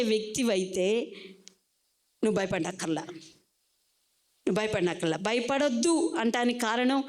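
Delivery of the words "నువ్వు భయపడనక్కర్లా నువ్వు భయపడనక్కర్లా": reassuring. Acted. Reassuring the congregation.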